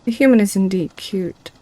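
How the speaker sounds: ordinary delivery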